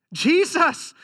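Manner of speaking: high, loud cry of recognition; joyful cry. Acted. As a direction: call his name in sudden joy. Mary recognizes Jesus, cries out.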